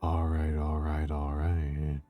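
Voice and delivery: low, soft drawled all right; low, lazy movie-star drawl; Matthew McConaughey. Acted. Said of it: "My A S M R voice sounds like Matthew McConaughey".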